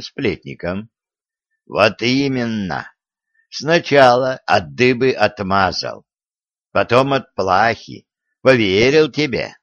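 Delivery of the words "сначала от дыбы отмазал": ordinary delivery, nothing marked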